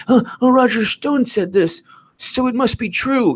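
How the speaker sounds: very high, squealy mock voice; mock gullible media. Mocking media swallowing Roger Stone's words, squeaky high. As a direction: parody the starving news crowd, high and silly. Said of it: "They're like".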